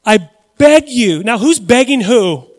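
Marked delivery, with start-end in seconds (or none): 0.0-1.2 demon pleading. Retold or imitated. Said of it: "the demons".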